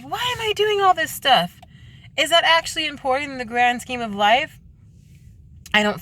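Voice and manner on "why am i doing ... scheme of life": retold incredulous outburst, much higher; exasperated self-questioning. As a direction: pitch shooting up in disbelief. Acting out her frustrated questioning.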